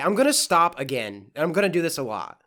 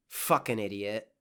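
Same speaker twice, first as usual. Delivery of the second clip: lower, softer muttered insult; deadpan aside; 10 semitones lower, 9 dB quieter than usual. Real, not acted. After mocking Lisa, a low deadpan insult. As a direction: drop the act and mutter it.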